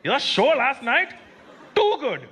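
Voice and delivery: put-on high, stretched-out Indian-accented praise; hammed-up Indian accent, punching each compliment; emphatic Indian accent. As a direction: gush like a fan in a thick Indian accent. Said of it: "They were so emphatic with their compliments".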